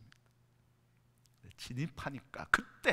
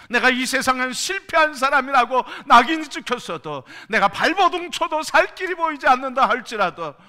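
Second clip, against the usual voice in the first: loud, high, rising sermon cry; preaching at full force; 12 semitones higher, 18 dB louder than usual. Acted. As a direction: pound each clause at the congregation.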